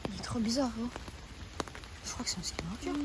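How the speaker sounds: hushed whisper to friend. Real, not acted. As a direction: whisper so he can't hear. Whisper about stranger.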